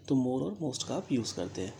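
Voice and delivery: normal talking voice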